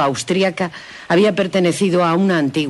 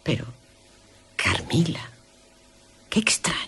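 susurro; 2 semitones higher, 8 dB quieter than usual. Acted. Laura piensa en voz baja.

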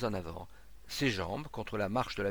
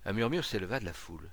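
ordinary delivery

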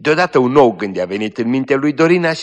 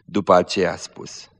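usual speaking voice

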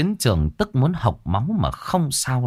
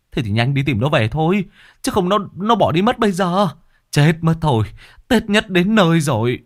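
flustered; 8 semitones higher than usual. A narrator's reading. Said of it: "ai nấy nhốn nháo".

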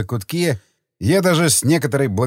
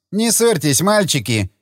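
high, mincing and coy; 4 semitones higher than usual. A narrator's reading. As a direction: camp it up, coyly. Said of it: "он жеманно произнёс".

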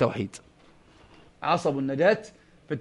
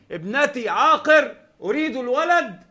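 high, slow, soft begging voice; imitated plea; 7 semitones higher, 5 dB quieter than usual. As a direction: mimic someone pleading at a shrine. Imitates a pleader at Badawi's shrine. (تقليد متوسل بصوت عالي النبرة بطيء خافت)

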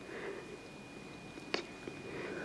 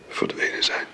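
grief-hushed; 6 dB quieter than usual. Acted. Grief breaks the voice.

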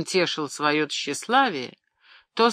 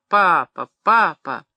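put-on louder child's call of papa; 6 dB louder, slower than usual. A narrator's reading. Daughter calling her raging father, loud call. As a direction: tug at him and call for attention.

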